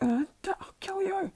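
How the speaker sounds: strained whispery mock yell; Bakugo's threat. Injured Bakugo threatens his rival, whispery mock fury. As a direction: groan out a high, hushed threat.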